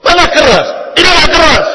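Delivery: loud, high angry yell; shouting husband. Retold or imitated. Husband yelling at his wife that he isn't loud. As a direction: shout that you're not shouting. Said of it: "Dibentak-bentak istrinya".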